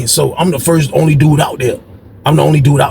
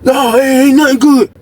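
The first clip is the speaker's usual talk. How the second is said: pitched-up upset rant; upset Lee; 9 semitones higher than usual. Retold or imitated. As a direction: complain like Lee being cheated. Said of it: "Lee like".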